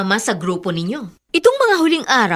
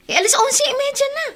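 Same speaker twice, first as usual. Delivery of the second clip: 1 exasperated scolding. Acted. Older sister scolding about curfew.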